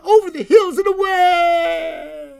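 loud, high, drawn-out swoop; soaring narration. A narrator's reading. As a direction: send the voice flying away over the hills. Soaring flight acted in narration.

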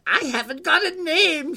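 high-pitched creature voice; high, sing-out creature reply; little night creature. Acted. Creature answers girl's question, high impish voice.

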